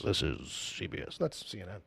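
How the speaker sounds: "This is C B S." in a deep network-ident voice; deep announcer voice. Pretend.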